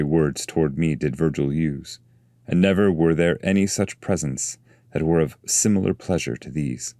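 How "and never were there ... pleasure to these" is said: ordinary delivery, nothing marked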